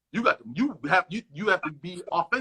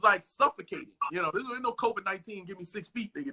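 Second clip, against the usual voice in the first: ordinary delivery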